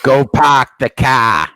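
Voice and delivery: put-on slow Boston accent, R dropped in park and car. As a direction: drawl it like a Bostonian, no R. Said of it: "pronounce an R that comes after an A".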